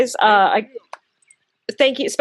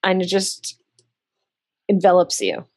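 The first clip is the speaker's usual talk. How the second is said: soothing narration; 4 semitones lower than usual. Acted. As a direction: ease them into a sense of comfort. GM describes comforting warmth.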